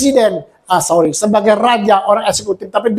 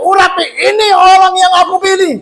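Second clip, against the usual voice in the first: high, loud commanding shout; voice of God; 10 semitones higher, 5 dB louder than usual. Acted. God commanding Samuel to anoint the chosen one, booming. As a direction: proclaim it as God giving the order.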